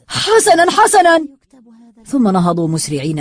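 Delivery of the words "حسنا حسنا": high, frantic yell of surrender; screamed. A narrator's reading. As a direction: cry out giving in. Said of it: "فصرخ أحدهم".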